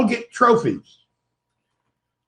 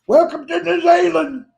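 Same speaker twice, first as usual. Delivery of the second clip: a higher, louder mock hostess welcome; Miss Poppy impression; 7 semitones higher, 3 dB louder than usual. Mocking the New Zealand host for the stream, a higher hammed-up welcome. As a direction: sing out her greeting with a hammed-up pose. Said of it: "ballet dancing broadcast pose".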